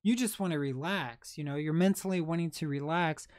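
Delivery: ordinary talk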